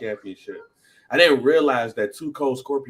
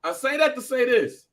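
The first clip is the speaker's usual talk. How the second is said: dramatic reveal; 13 semitones higher than usual. Acted. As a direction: pitch it high and draw out the suspense. Builds to a trivia reveal.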